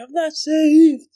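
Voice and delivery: high, loud, drawn-out mock wail of a scared child; mock panicked wail. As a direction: wail it like a terrified kid sure he's doomed. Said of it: "I was like".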